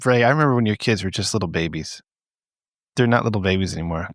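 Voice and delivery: usual speaking voice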